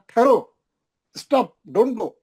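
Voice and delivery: high, loud ठहरो on its own, then stop, don't go; firm halt on ठहरो before translating it; pause after ठहरो. Shown as a demonstration. Teacher giving the intended meaning, pause after ठहरो. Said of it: "उन्हें बोलना चाह रहा था".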